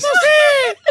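high, tiny whimpered yes; squeaky tearful wife. Retold or imitated. Husband imitating his crying wife's tiny yes. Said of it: "¿Por qué?".

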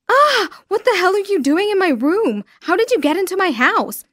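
high, loud alarmed yell at the intruder; startled shout. Acted. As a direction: shout in shock at someone in your room. Intruder found in his room.

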